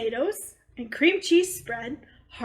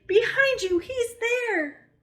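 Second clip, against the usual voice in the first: put-on child screaming at mom; screamed; 10 semitones higher than usual. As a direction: yell a warning. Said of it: "screamed Eddie".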